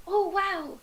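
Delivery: mildly awed, excited small alien. Acted. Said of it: "And it's so excited".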